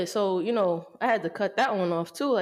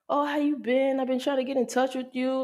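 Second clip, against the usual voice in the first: higher-pitched mock friendly greeting; imitated ex; 6 semitones higher than usual. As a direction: imitate her casual hello in a higher voice. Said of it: "and she was like".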